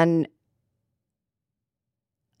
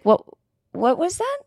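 imitated confusion, jumping high into a question; blank puzzlement; 10 semitones higher than usual. Waitress forgetting the wine she recommended, high puzzled question. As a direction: ask it with no memory at all, voice rising high.

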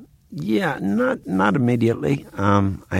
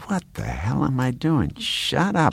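exasperated higher-pitched inner voice; mock self-scolding; 8 semitones higher than usual. Actor chiding himself for getting chatty, mock exasperation. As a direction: scold yourself in mild disbelief.

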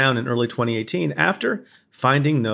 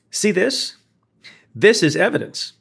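raised pitch, pointed stress on "this"; emphatic courtroom rebuttal; 5 semitones higher than usual. Retold or imitated. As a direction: hit "This" high as if presenting proof. Retold courtroom display to the jury.

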